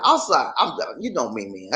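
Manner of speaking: usual speaking voice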